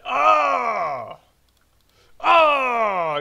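loud, high shout of excitement; ear-splitting joyful scream. Real, not acted. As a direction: scream out your joy. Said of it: "ripping your ears out".

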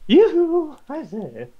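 high hammed-up toff call; a high, theatrical posh hello; posh yoo-hoo. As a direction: sing it out like a silly gentleman.

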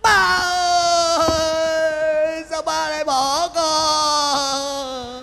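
loud sobbing wail, drawn out slowly and half sung; hired mourner wailing for his father. Acted. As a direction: wail over the coffin like a paid mourner.

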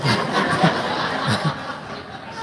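short breathy laugh after the story; real amused laugh. Real, not acted. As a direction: laugh along with the room.

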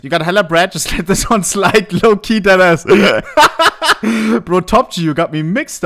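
can't hold the laugh while reading. Real, not acted. Streamer reading a viewer's cheeky offer, laughing through it.

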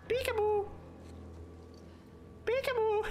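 very high falsetto taunt; teasing high-pitched singsong; playful peekaboo. Pretend. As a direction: squeak it like a game with a baby. Teasing enemies while hiding.

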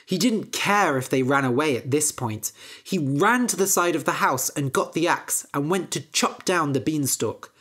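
ordinary delivery

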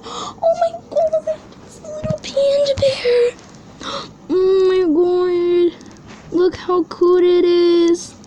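very high, drawn-out gushing; adoring squeal. Real, not acted. Collector seeing the little panda bear, adoring squeal. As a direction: gasp and squeal slowly with delight.

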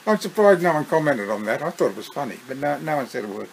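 usual speaking voice

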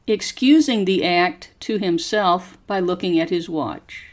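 slow, careful story reading for students to repeat; slow model reading. Shown as a demonstration. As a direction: pause after each phrase and lift the tune clearly. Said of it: "Copy how my voice goes up and down".

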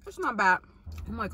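slightly muffled mouth full; speaking between bites; talking while chewing. Real, not acted. Seen 3: Just ate before; closed-lip chewing, hand covers mouth at 0.9.

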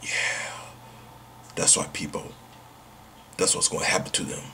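fast, unvoiced threatening whisper; menacing whisper. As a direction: hush it like a threat to the goblins. Threat to ghosts and goblins.

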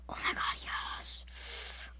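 breathless whispered gasp; whispering. Real, not acted. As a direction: gasp then whisper in suspense. Tense moment, whispered.

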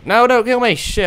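high panicked yell; panicked shout. Real, not acted. About to be killed in game.

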